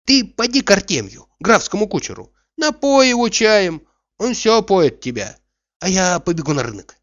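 high-pitched, lively woman's voice; shrewd servant woman. A narrator's reading. Narrator voices cook Anisya advising.

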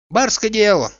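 high, lifted woman's voice; quick-witted, high-pitched comment; shrewd servant woman. A narrator's reading. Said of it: "заметила сметливо Анисья".

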